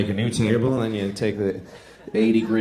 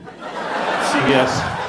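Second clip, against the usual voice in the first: slow, breathy ad slogan whisper; mock slogan; much slower than usual. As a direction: say it like a hushed tagline. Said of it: "That should be their slogan".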